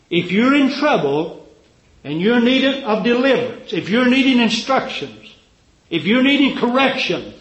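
high, loud preaching build. Acted. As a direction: drive it home like a revival preacher. Preaching at full force.

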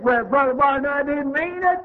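high, pleading woman's voice; pleading sick woman. Retold or imitated. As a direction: beg him like the frightened patient.

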